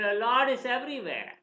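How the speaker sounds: high, loud proclamation; ardent declaration. Retold or imitated. Prahlad answering his angry father, proclaiming.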